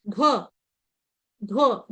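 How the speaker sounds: usual speaking voice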